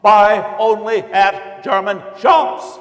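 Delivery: loud, high stormtrooper slogans, slowing at the end; shouted slogans. Acted. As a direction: bellow the boycott slogans at passers-by. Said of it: "shouting slogans and holding signs".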